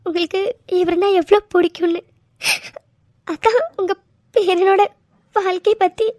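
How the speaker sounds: sorry through tears, crying; tearful apology. Acted. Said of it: "நீ அழுகையை நிறுத்த மாட்டேன்". (அழுதுகொண்டே மன்னிப்பு கேட்கும் குரல்)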